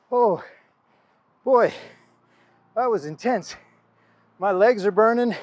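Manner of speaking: panting after a hundred strikes; breathless, catching breath between words. Real, not acted. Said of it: "I'm out of breath".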